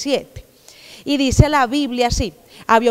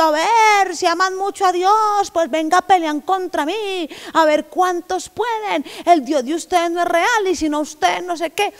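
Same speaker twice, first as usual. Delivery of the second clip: taunting giant; 7 semitones higher than usual. Acted. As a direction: sneer at them like a bully giant. Goliath taunting God's people.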